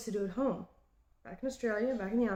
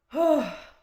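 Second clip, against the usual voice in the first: heavy sigh; 5 semitones higher, 8 dB louder than usual. Real, not acted. Fighting tears after homesick confession.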